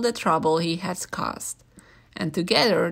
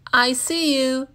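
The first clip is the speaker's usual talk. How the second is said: bright, louder teasing call; playful gotcha; 6 semitones higher, 4 dB louder than usual. A narrator's reading. Zookeeper catching George hiding, playfully. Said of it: "he said".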